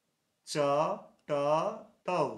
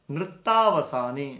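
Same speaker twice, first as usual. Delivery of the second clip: নৃত্যাবসানে said loud and drawn out; slow, loud emphasis; 8 dB louder, slower than usual. Shown as a demonstration. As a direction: hit নৃত্যাবসানে loudly before explaining. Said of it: "repeats verse word to explain". (নৃত্যাবসানে জোরে টেনে)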